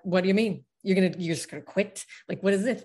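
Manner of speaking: the brain scoffs at quitting; retold indignant inner mind; skeptical mind. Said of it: "my brain was like".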